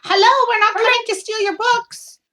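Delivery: loud, high-pitched call to someone unseen. Acted. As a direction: call out reassurance into the room. Calling to unseen occupant of room.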